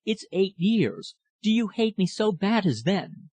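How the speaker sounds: high, anxious pleading, mildly acted. A narrator's reading. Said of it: "she said anxiously".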